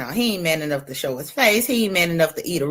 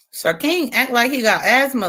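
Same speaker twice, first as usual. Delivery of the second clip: usual speaking voice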